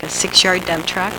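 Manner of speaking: usual speaking voice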